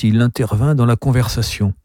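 ordinary delivery, nothing marked

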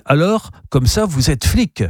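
a hint of a nervous host probing; uncle questioning. Uncle nervously asks the visitor if he's a cop.

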